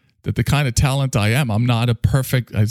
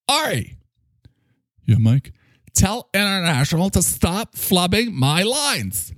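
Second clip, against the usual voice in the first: high pompous boss voice; 10 semitones higher than usual. Acted. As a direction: play a self-important leader delivering news. Boss character scolds.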